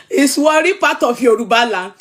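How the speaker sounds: ordinary delivery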